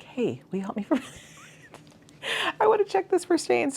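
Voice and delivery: high put-on voice, asking a stranger for help; mock polite ask. Imagining asking a thrift store stranger, a high mock plea. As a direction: squeak out an awkward favour with a laugh. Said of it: "go up to a stranger and be like".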